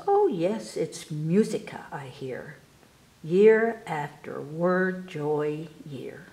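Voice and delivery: ordinary delivery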